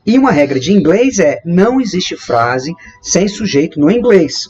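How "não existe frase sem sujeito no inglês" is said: usual speaking voice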